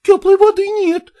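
high, loud servant's reply; Fyokla calling back. A narrator's reading. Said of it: "откликнулась Фёкла".

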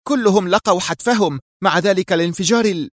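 high-pitched, vexed reply; annoyed. A narrator's reading. Mira admits the losses to her boss, vexed. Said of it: "أجابت في ضيق".

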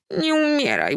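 put-on high, begging child's voice; little girl's high plea; pleading girl. A narrator's reading. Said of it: "błagała Salcia".

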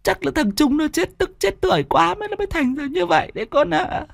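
acted tearful lament, rising into a high wail; tearful mother. A narrator's reading. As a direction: sob through the words as a grieving mother. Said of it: "lại sụt sùi". (giọng người mẹ sụt sùi, nghẹn ngào)

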